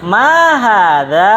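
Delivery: teacher saying Ma hadza for the class to echo; teacher drill. Shown as a demonstration. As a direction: model the phrase slowly for repeating.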